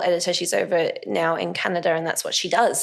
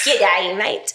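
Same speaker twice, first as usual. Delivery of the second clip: hammed-up Aussie greeting, broad vowels; terrible Australian accent; 3 dB louder than usual. Mocking Americans attempting Australian, terrible Aussie accent. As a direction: do a badly faked Australian accent. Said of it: "their terrible accent of trying to do the Australian one".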